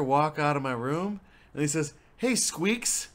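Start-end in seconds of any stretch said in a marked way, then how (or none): 2.2-3.1 high-pitched teasing dad impression; teasing greeting. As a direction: needle the guest with a nickname. Dad teases the overnight guest.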